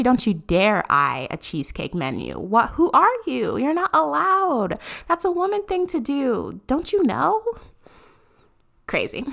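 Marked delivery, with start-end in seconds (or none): 2.7-7.4 high, mocking know-it-all; mock tweeter voice. Mocking the tweeter to her listeners, high and smug. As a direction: mimic the smug tweeter, pitched up. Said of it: "couldn't even say that with a straight face".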